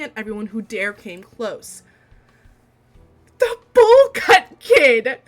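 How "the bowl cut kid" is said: very high, very loud, slow squeal; excited shout. Real, not acted. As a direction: shriek it out in recognition. Reader recognizing the character.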